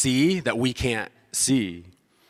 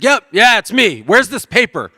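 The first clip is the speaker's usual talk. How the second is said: much higher, louder impatient reply; stressed husband snapping back up the stairs; loud, curt answer to his wife; gruff husband; 9 semitones higher, 10 dB louder than usual. Retold or imitated. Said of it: "He's like".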